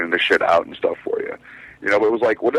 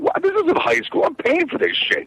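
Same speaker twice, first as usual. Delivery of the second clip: much higher, indignant mock protest; indignant outburst. Student protesting to the yelling teacher, high-pitched mock indignation. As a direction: squeak out your outrage at being yelled at.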